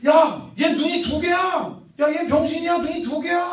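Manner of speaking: high, loud crowd taunt; jeering mockery. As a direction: tease like a mob of monkeys. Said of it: "막 놀리는 거야".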